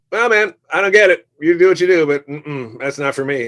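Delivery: put-on blunt brush-off, starting high and loud, easing down; blunt verdict. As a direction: imitate a frank friend, loud at first. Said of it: "it's very blunt".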